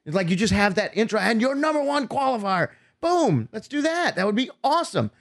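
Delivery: high, bouncy mock enthusiasm; excited retelling. Retelling organisers loving the intro idea, high and excited.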